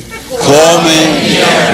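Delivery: "Come mierda" drawn out very slowly with a laugh; slow drill. Shown as a demonstration. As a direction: stretch out "Come mierda" word by word for the class. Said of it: "One, two, three".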